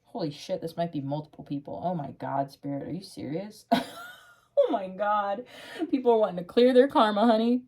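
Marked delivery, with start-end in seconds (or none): 4.6-7.6 fast, loud, high burst of excitement; blurting out the news to the viewer; excited. Real, not acted. To her viewer, thrilled by the cards, fast and loud. Excited tarot reader reacting.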